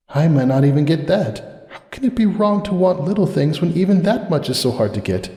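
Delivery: hurt, resentful murmur to herself; bitter thought. A narrator's reading. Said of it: "she thought bitterly".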